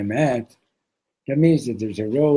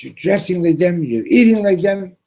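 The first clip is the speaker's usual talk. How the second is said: higher, louder panicked outburst; rising frantic accusation; freaking out; 7 semitones higher, 8 dB louder than usual. Acted. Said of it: "the prince starts freaking out".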